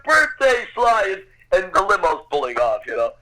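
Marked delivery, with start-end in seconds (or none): 0.0-1.2 higher mock yell at a departing limo; retold yelling. Father calling after Stallone's limo, higher mock yell. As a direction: call out after the car, half-acted. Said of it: "starts yelling to the".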